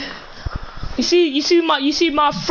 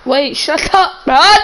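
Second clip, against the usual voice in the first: loud, slow yell; shouting; 4 semitones higher, 9 dB louder, slower than usual. Real, not acted. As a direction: shout it out to shut him up. Yelling at friend mid-game.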